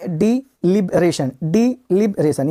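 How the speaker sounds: डिलिबरेशन drawn out slowly, di-li-bre-shun; slow modelling. Shown as a demonstration. Teacher slowly modelling डिलिबरेशन for students. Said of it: "next देखेंगे यहाँ पे है डिल".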